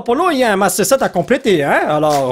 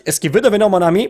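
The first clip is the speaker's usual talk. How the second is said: ordinary delivery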